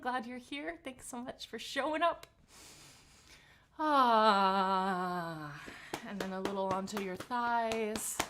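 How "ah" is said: resonant ah. Shown as a demonstration. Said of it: "little pat on your belly".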